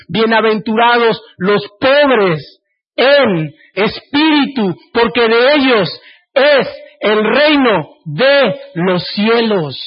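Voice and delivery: ringing, slow beatitude; proclaiming. Acted. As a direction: declare it from the pulpit slowly. Quoting Jesus emphatically.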